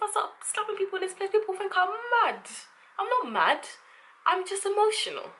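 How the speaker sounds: imitating Lucas. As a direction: parody the Backchat guy's defence. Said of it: "people will think I'm mad if I do this".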